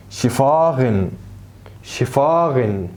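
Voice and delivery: "شفاغن" very slow, shva-gen; slow German sch. Shown as a demonstration. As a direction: draw out "شفاغن" with a sh start. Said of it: "الاس كي اي تنطق ش".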